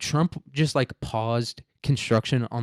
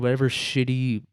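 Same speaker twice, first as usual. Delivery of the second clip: usual speaking voice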